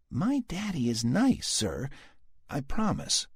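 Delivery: put-on high little girl voice; earnest small girl reassuring. A narrator's reading. Sam to Dallas, small girl's voice.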